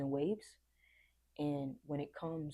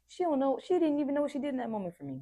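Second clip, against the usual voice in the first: excited; 11 semitones higher, 7 dB louder, faster than usual. Real, not acted. Moved, emphatic aside.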